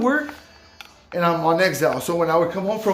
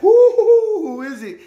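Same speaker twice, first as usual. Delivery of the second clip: high, loud, drawn-out whoop; mock a nosy crowd stirring up drama; excited curiosity; 7 dB louder, much slower than usual. Residents wonder who is in trouble, high excited whooping.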